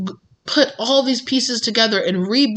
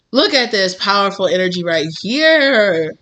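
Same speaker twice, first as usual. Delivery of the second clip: ordinary talk, nothing marked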